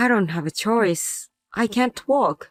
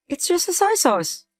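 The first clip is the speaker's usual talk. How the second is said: dismissive; 10 semitones higher than usual. Acted. Husband dismisses wife's complaint.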